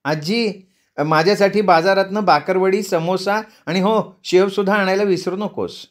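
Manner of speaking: lightly voiced child asking for snacks; granddaughter calling. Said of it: "बाल्कनीतनं आवाज आला".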